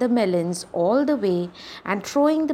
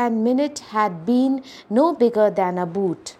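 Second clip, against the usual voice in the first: plain reading voice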